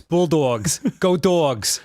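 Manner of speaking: loud New Jersey "Bulldogs", "dawgs" vowel; Jersey accent. Shown as a demonstration. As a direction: cheer it in a thick Jersey accent. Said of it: "I have to say it in the Jersey accent".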